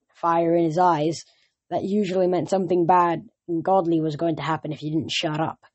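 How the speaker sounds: ordinary delivery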